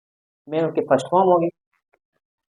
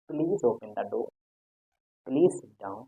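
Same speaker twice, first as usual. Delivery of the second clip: softer, polite please; gentle request; 5 semitones lower, 10 dB quieter, much slower than usual. Shown as a demonstration. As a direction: ask softly and kindly. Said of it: "आराम से प्यार से बोला जाता है".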